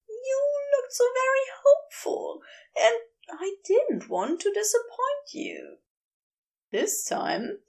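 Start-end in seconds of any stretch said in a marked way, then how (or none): 0.1-5.8 higher, heated fussy angel voice; Aziraphale, wild. A narrator's reading. Angel ranting at the geologist, high and heated. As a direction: raise the pitch and let him rant.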